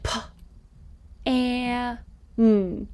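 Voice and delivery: p, and, n said apart; separate sounds. Shown as a demonstration. Teacher segmenting pan into sounds. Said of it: "take each of those sounds".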